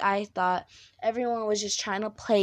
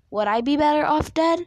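anguished cry; 6 semitones higher, 8 dB louder than usual. Retold or imitated. Retelling her darkest thought.

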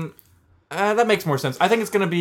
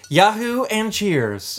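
slow catchphrase; 7 semitones higher, much slower than usual. Shown as a demonstration. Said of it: "cool catchphrase".

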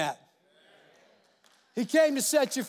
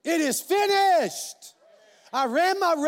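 triumphant; 5 semitones higher, slower than usual. Pretend. Pastor imagining his own tombstone, joyful triumphant shout. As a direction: announce it like crossing the finish line. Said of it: "put that on my tombstone".

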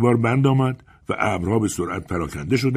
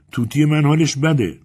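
higher, louder complaint; protesting; 8 semitones higher, 5 dB louder than usual. A narrator's reading. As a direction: object with urgent distress. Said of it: "اعتراض کنان گفت".

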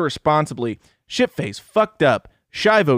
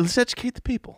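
high mock slogan; 9 semitones higher, 5 dB quieter, faster than usual.

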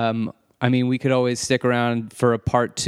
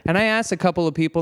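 ordinary talk, nothing marked

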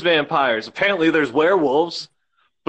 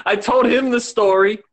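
ordinary delivery

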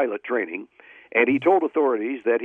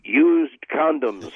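slow deadpan punchline; much slower than usual. Acted. Punchline delivered slowly.

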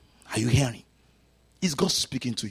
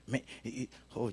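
normal talking voice

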